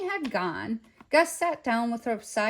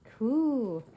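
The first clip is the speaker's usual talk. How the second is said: contented coo; 3 semitones higher than usual. A narrator's reading. Baby ghost with a clean diaper, contented coo. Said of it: "said the baby ghost contentedly".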